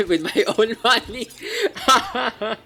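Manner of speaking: laughing. Real, not acted. Laughs at own joke.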